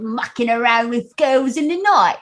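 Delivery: stage British accent for Pinter, with "night" as "noight"; put-on British accent. Shown as a demonstration. Drama student redoes the Pinter line in a British accent. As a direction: say it like a British actor doing Pinter. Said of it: "we had to do the British accent for it".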